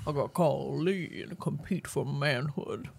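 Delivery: low, slow, soft macho voice; mock brother voice. Host playing Lee's brother before calling him, low and slow. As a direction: growl it out like a rival brother. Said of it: "now I'm your brother calling".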